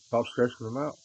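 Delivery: ordinary talk, nothing marked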